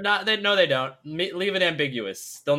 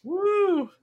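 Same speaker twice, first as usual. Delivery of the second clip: high whooping cheer; excited whoop. Real, not acted. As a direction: let out a joyful woo.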